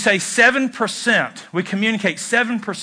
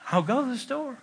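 much softer, gentle offer; soft and willing; 4 semitones higher, 10 dB quieter than usual. Shown as a demonstration. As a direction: say it quietly and kindly. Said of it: "there's a difference between saying".

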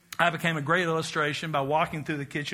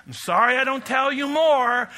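emphatic declaration; 7 semitones higher, 5 dB louder than usual. Shown as a demonstration. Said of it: "So here they are".

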